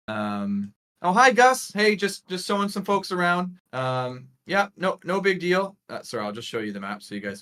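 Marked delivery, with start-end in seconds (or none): 1.0-5.8 high, louder hello to an acquaintance; friendly greeting. Acted. Guide greeting Gus through the portal, breezy and friendly. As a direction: call out breezily, pitched well up. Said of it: "she sort of waves through the portal".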